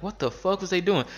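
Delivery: high, baffled cry of disbelief; incredulous outburst. Retold or imitated. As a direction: react like you can't believe it. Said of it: "And I'm like".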